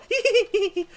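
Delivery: high-pitched tittering; giddy giggle. Acted. Giggles after drugs, condom joke.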